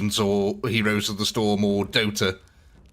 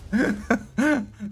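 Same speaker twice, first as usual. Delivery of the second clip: high breathy giggle after the voice; 10 semitones higher than usual. Real, not acted. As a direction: laugh at your own silly voice. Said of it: "Sorry, I can't help but".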